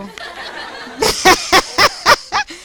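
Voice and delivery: high burst of laughing; laughter. Real, not acted. Teacher realizes the students were right, laughing.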